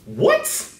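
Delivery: very high-pitched, incredulous one-word outburst; real high squeal of astonishment; shocked disbelief. Real, not acted. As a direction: shoot the pitch right up in disbelief. Shocked at the insult just quoted.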